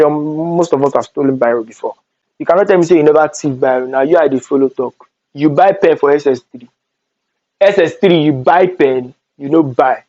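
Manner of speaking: ordinary delivery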